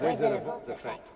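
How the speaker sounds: breathy processed whisper; unvoiced processed echo; whispery wizard effect. Shown as a demonstration. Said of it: "This is a kind of Wizard of Oz effect".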